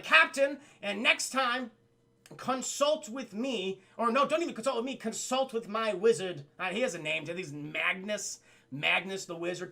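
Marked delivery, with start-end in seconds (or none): 0.0-6.4 high, loud royal command; imperious princess. Acted. Princess reprimanding her captain, imperious. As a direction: scold him in a haughty mock princess voice. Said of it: "And she's like".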